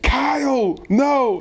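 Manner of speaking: high-pitched mock yell at a teammate; imitated yell. Veteran lineman yelling at a confused teammate, high imitated yell. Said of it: "Garza would be yelling at him".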